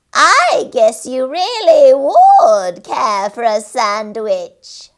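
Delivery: high, loud, slow cackle voice; cackling creature voice. A narrator's reading. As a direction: gloat like a little witch. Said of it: "she cackled".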